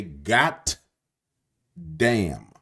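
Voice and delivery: "got damn" with a hard T on got; "got damn", the T of got clearly sounded; New Orleans "got damn". Shown as a demonstration. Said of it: "we say".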